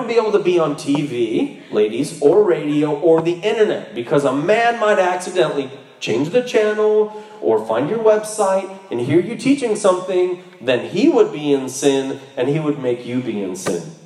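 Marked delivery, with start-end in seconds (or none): none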